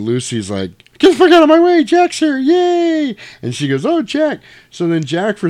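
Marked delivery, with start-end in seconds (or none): 1.0-3.2 put-on very high, loud girly falsetto squeal; mock swooning girl. Lucy thrilled Jack arrives. 3.9-4.4 put-on very high, loud girly falsetto squeal; mock swooning girl. Lucy swooning over Jack.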